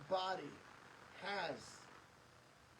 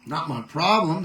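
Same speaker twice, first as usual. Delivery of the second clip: much louder blunt declaration; loud outburst; 22 dB louder than usual. Real, not acted. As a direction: blurt it out hard and loud. Rejecting blame, sudden outburst.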